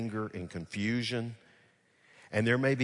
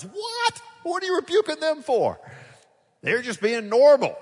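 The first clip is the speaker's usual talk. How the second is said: much higher, louder what, baffled; incredulous protest; 10 dB louder than usual. Pretend. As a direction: protest on the disciples' behalf. Incredulous reaction to the rebuke.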